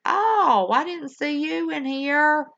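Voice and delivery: high, loud oh of sudden recognition; spotting a friend unexpectedly; surprised greeting. Real, not acted. Host spots a viewer in chat, surprised hello.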